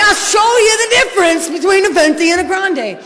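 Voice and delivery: shouted high and loud, laughing through it; angry retort. Retold or imitated. Impatient addict yelling back at the Starbucks lady, laughing. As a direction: snap back at her like a furious customer. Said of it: "And I'm like".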